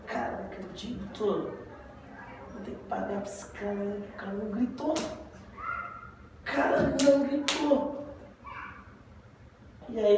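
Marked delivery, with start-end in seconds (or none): 0.0-4.9 quiet, muted disbelief; mock dejected grumbling under the voice; frustrated. 4.9-8.0 drawn-out, high mock lament; acted slow, high-pitched disbelief; frustrated.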